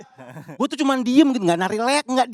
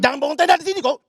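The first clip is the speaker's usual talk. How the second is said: put-on scolding the kids; 8 semitones higher, 5 dB louder than usual. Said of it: "satu lagi bangun tenda".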